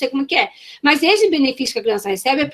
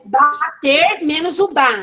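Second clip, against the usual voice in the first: slow, syllables held apart; syllable demonstration; much slower than usual. Shown as a demonstration. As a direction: repeat it slowly for learners. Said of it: "manipulação silábica".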